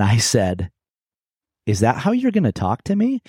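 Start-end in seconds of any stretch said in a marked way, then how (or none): 1.7-3.2 high, startled question; almost surprise. Retold or imitated. Husband caught off guard by his wife's hurtful words, surprised. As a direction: ask it genuinely taken aback. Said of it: "almost surprise".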